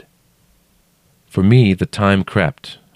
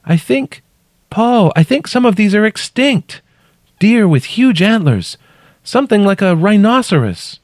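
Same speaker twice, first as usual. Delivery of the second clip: very high voice calling from below; 4 dB louder than usual. A narrator's reading. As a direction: call up your puzzled discovery. Trapped woman calling up.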